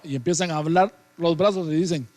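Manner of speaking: ordinary delivery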